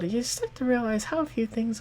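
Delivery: ancient goddess. Acted. Goddess telling Verena about her age, otherworldly and weary. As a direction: sound impossibly old and tired.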